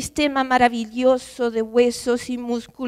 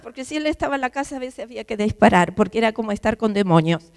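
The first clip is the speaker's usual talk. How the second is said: usual speaking voice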